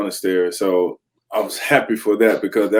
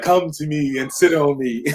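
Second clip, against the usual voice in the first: alluring pillow voice; 5 semitones higher than usual. Pretend. Voicing the pillow.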